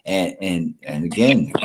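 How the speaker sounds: ordinary talk, nothing marked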